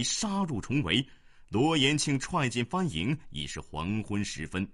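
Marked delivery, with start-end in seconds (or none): none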